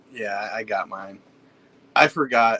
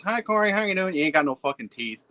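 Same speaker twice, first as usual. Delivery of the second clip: meth head greeting; 7 semitones higher than usual. Retold or imitated. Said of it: "he goes".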